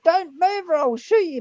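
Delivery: high, loud warning from a cop; mock police shout. Cop warns the driver at gunpoint, imitated high shout. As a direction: shout it as an armed officer would.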